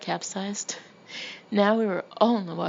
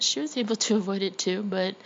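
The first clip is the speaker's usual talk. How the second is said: ordinary delivery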